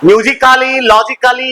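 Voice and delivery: musically, logically both with a long "kaa" before "lee"; wrong -CALLY endings. Shown as a demonstration. Teacher listing what not to read, musically, logically with sounded "kaa". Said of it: "इसको नहीं पढ़ोगे".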